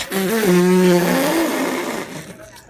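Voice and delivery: big loud laugh; loud burst of laughter. Real, not acted. Fan cracking up at own rant, loud laugh. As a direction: crack up at yourself.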